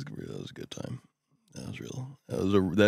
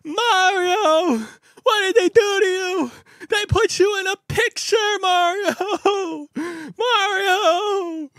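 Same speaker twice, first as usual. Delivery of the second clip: put-on high, loud, wailing kid crying out a name; 11 dB louder, much slower than usual. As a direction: cry out to the poster like a distraught five-year-old. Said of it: "and he goes".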